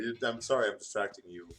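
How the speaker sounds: ordinary talk, nothing marked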